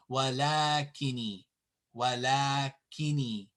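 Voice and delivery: walakini with a long, held la; walakini, "la" stretched to two counts; long vowel. Shown as a demonstration. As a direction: draw out the middle a of walakini. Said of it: "tells us that there is an alif".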